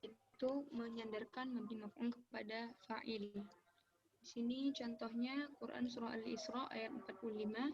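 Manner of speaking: suara sangat pelan dari mikrofon jauh; barely audible. Real, not acted. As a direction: hampir tak terdengar, mikrofon lemah. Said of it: "suaranya terlalu kecil". (suara nyaris hilang)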